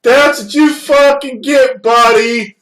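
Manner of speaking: very high, loud, slow gloating shout; Ashley Miller voice. Retold or imitated. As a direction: gloat at the crashed driver like a Canadian skater girl. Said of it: "Ashley Miller talks like".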